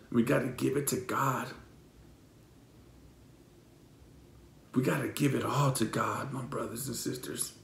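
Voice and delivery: low, soft, fading to a whisper; hushed plea. Real, not acted. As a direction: plead quietly, letting the voice drop away.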